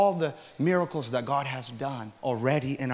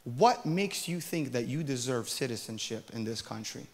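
softer, cold accusation; quiet stern rebuke; 3 semitones lower, 10 dB quieter than usual. Retold or imitated. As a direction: scold him in a low, hard voice. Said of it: "And she says".

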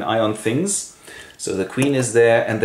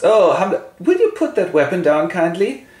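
a touch of alarm, pitched up; nervously polite request, lightly acted; startled king; 8 semitones higher, 3 dB louder than usual. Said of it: "And the king comes".

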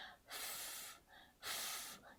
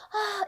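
sigh; 10 semitones higher, 4 dB quieter than usual. Acted. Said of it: "acted hot sigh".

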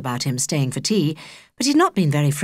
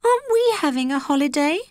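put-on high, young girl asking; small girl's voice; 10 semitones higher than usual. A narrator's reading. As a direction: plead gently like a young girl. Holly pleading for a holiday.

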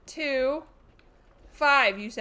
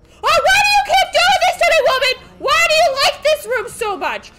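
high, loud panicked yelling; screaming in exasperation at her; frantic shout; 14 semitones higher, 11 dB louder than usual. Real, not acted. Streamer yelling at the woman who keeps chasing her, high and loud. Yelling at stalking villain in game.

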